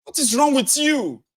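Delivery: high, indignant complaint; high mock indignation; scolding bystander. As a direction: scold the adult for hitting a child.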